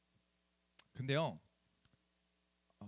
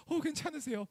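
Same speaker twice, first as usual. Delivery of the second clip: much higher, fussing auntie voice; concerned lady; 10 semitones higher than usual. Retold or imitated. Two ladies run over to the fallen pastor, high worried voice. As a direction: play the worried ajumma checking on him.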